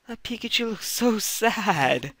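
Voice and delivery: mock pity. Real, not acted. Pities sad Pikachu on screen.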